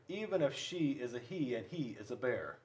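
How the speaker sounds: ordinary delivery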